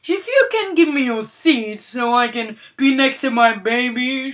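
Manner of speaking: loud entitled parent; entitled mom voice. Acted. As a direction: talk down to her like she's stupid. Entitled mom demands seat.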